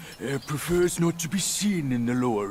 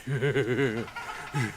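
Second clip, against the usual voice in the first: mocking chuckle; 4 semitones lower, 5 dB quieter than usual. Laughs off the accusation.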